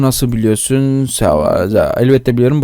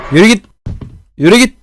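loud, much higher yell; shouting away; 8 semitones higher, 6 dB louder, faster than usual. Real, not acted. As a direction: drive the monster off with a yell. Shooing off a scary figure.

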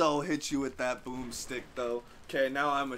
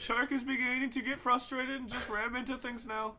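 higher playful narrator voice; mock wildlife commentary; nature documentary; 8 semitones higher than usual.

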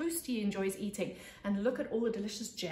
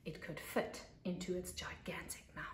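spooky whisper; 3 semitones lower, 8 dB quieter than usual. Acted. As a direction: whisper it, hushed and ominous. Monster swallowing everything, hushed.

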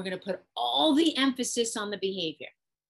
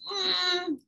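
put-on balking child; 10 semitones higher, 3 dB louder than usual. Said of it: "Child goes".

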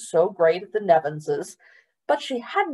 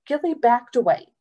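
ordinary delivery

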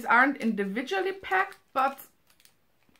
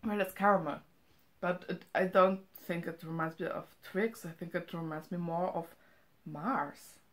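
chewing while talking; 3 semitones lower, 4 dB quieter than usual. Real, not acted. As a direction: mouth partly full. Seen 1: Bites chocolate just before; hand covers mouth while speaking after.

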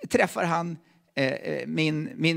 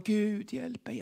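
higher but unforced plea to God; retold cry for help; 4 semitones higher, 6 dB quieter, much slower than usual. Father on the train calling to God for help, restrained retold cry. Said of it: "Och han ropar".

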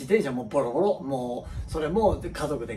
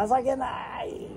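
dismayed parent; 10 semitones higher, 4 dB quieter, much slower than usual. Retold or imitated.

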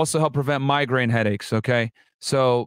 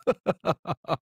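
soft laugh; 4 dB quieter than usual. Real, not acted. Laughing at own bit.

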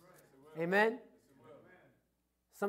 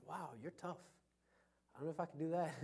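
mock awe; 5 semitones lower, 14 dB quieter, faster than usual. Mock reply to the tough man.

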